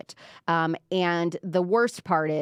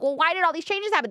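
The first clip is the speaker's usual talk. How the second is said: whiny complainer; 9 semitones higher, faster than usual. Retold or imitated. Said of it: "everyone complaining".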